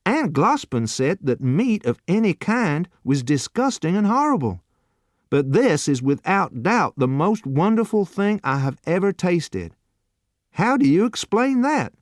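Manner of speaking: torn between aunt and taste; bewildered youth. A narrator's reading. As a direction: youth asking the waiter to explain.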